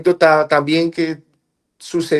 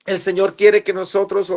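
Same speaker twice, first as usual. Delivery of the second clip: raised, earnest testimony to the congregation; fervent preaching; 5 semitones higher than usual. Acted. A believer exhorts his brothers not to lose heart, fervent preaching. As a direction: preach it out with conviction.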